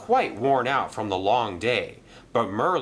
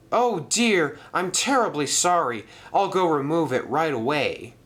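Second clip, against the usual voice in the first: higher, louder sorry engine; flustered apology; 7 semitones higher, 3 dB louder than usual. Acted. Arthur apologising to Mister Percival, higher and flustered. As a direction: apologise in a hurry.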